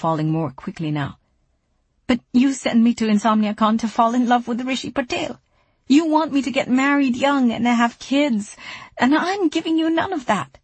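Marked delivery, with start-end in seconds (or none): 2.0-10.6 pleading through rising tears; near tears. A narrator's reading. Daughter breaking down to Mama, voice high and strained near tears. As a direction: voice high and strained on the edge of crying. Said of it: "Dimple began to cry".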